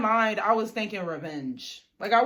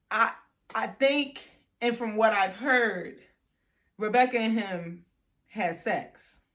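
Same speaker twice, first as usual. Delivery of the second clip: slow retold reveal, broken by pauses; halting repeat. Friend asked to say the rumor again, halting repeat. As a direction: repeat it phrase by phrase, pausing between. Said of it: "I'm sorry, say that again".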